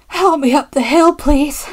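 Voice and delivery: effortful, panted appeal; panting. A narrator's reading. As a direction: plead as if puffing uphill. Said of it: "panted James".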